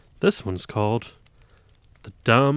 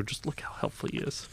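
whisper; 4 semitones higher, 11 dB quieter than usual. Real, not acted. Whispered aside.

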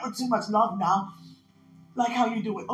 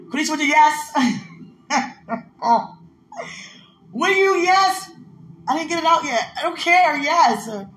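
loud, higher, laughing bursts of yes; ecstatic shouting; 9 semitones higher, 8 dB louder than usual. Real, not acted. Overwhelmed by God's love, loud laughing shouts. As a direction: shout with giddy joy between laughs.

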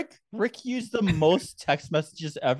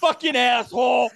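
mock outburst; 8 semitones higher than usual. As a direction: play the friend losing it, half laughing. Mocking Rick's outburst.

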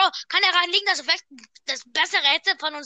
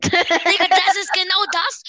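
loud; 3 semitones higher, 7 dB louder, slower than usual. Real, not acted. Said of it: "so laut rumzuschreien".

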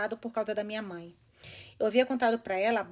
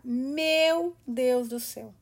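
slow stunned gasp of disbelief; real shock at the story; 4 dB louder, much slower than usual. Real, not acted. Reader shocked by the murder.

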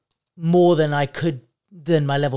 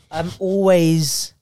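normal talking voice

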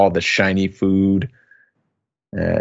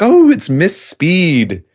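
excited greeting; 9 semitones higher, 5 dB louder, slower than usual. Retold or imitated. Crowd greets arriving Miss Speed.

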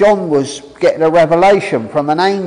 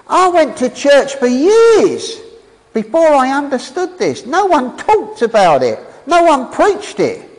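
raised, eager preacher's voice, pitch climbing higher; getting excited; 6 semitones higher than usual. Real, not acted. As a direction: let the excitement lift the pitch as you testify. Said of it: "I'm getting excited".

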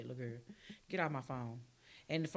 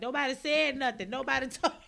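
mock mama protest; 6 semitones higher, 7 dB louder than usual. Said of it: "She was like".

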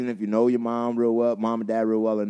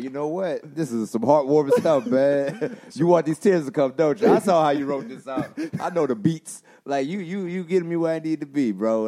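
higher, louder, half-laughing pep talk to a TV show; playfully calling out the show for making him cry; talking back to This Is Us, playfully worked up and louder; mock moved viewer; 6 semitones higher, 6 dB louder than usual.

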